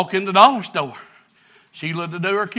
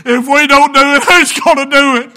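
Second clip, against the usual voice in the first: higher, pleading pulpit voice; preaching; 7 semitones higher, 4 dB louder than usual. Acted. Preacher asking his church who will love them, pleading. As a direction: press the question on them, rising.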